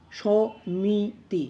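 সমিতি said slowly in separate syllables; syllable-by-syllable demo. Shown as a demonstration. Teacher showing how we pronounce সমিতি, slowly syllable by syllable. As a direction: space out the syllables of সমিতি, lengthening each vowel. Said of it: "উচ্চারণটা আমরা করি".

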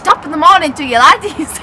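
loud Irish accent attempt. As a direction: copy a stage Irish greeting loudly.

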